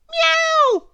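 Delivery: very high, loud meow; cat screech. Acted. Cat sat on in the heap, screeching. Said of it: "screeched the cat".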